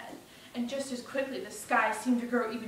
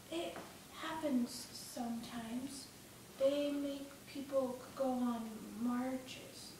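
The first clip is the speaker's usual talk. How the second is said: slow, hushed, halting answer; subdued boy; 8 dB quieter, much slower than usual. Acted. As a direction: explain it quietly, word by word. Frightened friend explains the marches quietly.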